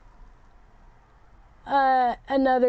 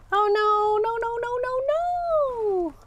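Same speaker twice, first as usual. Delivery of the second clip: dismayed no no; 9 semitones higher than usual. Real, not acted. Dismayed at a slip while painting.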